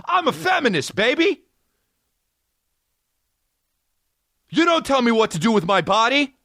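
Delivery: very high, yelled declaration; high proud shout; shouted punchline. Real, not acted. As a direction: yell it proudly at the room.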